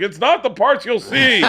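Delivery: high, loud, drawn-out reassurance to a crew; hammed-up director calling across set; acted shout back. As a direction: holler it slowly, on the edge of laughter.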